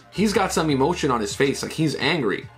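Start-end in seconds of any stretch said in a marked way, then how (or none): none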